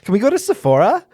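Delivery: put-on high, quick valley girl; ditzy valley girl asking. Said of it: "like, Valley High?".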